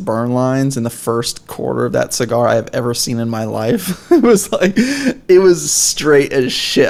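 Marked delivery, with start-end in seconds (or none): 4.1-6.9 laughing. Real, not acted. Laughing about the burn line.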